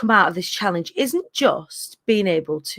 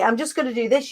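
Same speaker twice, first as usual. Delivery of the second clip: usual speaking voice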